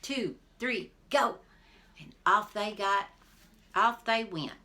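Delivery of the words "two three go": loud higher countdown; hollered countdown. A narrator's reading. Jack hollers the start of the foot race. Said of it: "And Jack hollered".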